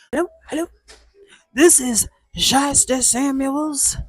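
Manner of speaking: high, put-on hustler voice, sweet-talking a mark; oily salesman answering the phone, baby-ing the caller; sleazy car dealer. Dealer Shiesty Samuel greeting a buyer on the phone, slick high hustler voice. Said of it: "he answers like".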